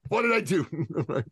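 slow, high drawn-out comic wail; hammed-up slow lament, pitched high; mock panic. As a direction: replay the helpless panic for laughs, stretching it out. Repeats the panic line after laughing.

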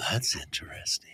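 soft, low whisper; a sly hushed aside. Real, not acted. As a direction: breathe it as a secret. Teasing reaction to racy clue.

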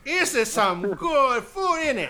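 high, loud, slow mock Cockney; Cockney voice over pub food; Cockney accent. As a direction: ham up a Cockney "innit", drawn out and high. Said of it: "they're not Cockney".

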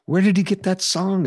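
high, excited disbelief; amazed questions. Retold or imitated. Friend hearing Fenario for the first time, excited disbelief. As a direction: burst out asking in wonder. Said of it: "Peter going".

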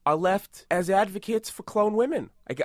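ordinary delivery

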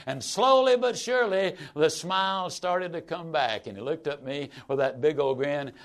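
ordinary delivery

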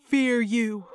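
defiant possessed howl. A narrator's reading. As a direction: howl it like a spirit has you. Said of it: "I howled with Martinos".

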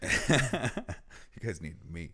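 slow, laughing repeat of his boast; mock the boast while laughing. Real, not acted. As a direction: chuckle through the words mockingly. Echoes friend's boast, laughing.

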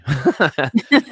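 a burst of real laughter; big amused laugh; loud laugh. Real, not acted. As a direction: laugh out loud at your own story. Laughs at own story.

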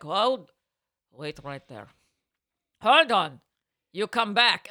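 loud Russian clerk calling someone back; mock-stern call down the aisle; imitated yell. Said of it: "she yelled into the aisle down at me".

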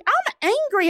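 high, exaggerated declaration of anger; mock angry declaration. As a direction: announce the anger with a laugh behind it. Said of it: "I was like".

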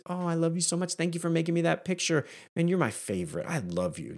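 soft, doting parent, starting high and settling; gushing tenderly over a kid's picture; caring. Retold or imitated. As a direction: heap love on a child. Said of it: "I'm caring and heaping love on them".

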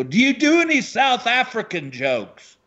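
high-pitched audience member calling a question; heckler from the darkness. Retold or imitated. Audience member to struggling comic, high-pitched query. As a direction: pipe up high from the crowd. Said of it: "out of the darkness, I hear this guy go".

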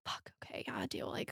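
hushed, unvoiced grudging okay; whispered reluctant yes. Teen girl giving in to boy, whispered.

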